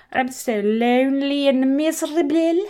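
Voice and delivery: a hammed-up lonely whimper; mock crying. Narrator voicing Cinderella left behind by the fire, mock crying. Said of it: "cry because".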